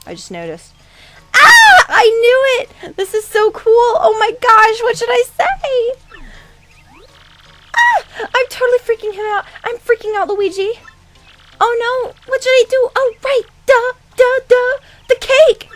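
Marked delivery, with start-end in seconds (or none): 1.3-5.9 very high, loud fangirl shriek; ecstatic fangirl. Acted. Fangirl meeting her idol Luigi, ecstatic. 7.8-15.7 very high, squealing panic; flustered fangirl. Acted. Fangirl freaking out in front of Luigi, flustered.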